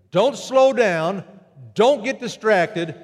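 each word pressed out slowly; urgent slow urging. Acted. Preacher urging his flock, slow and insistent. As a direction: drive the point home word by word.

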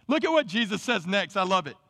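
ordinary delivery

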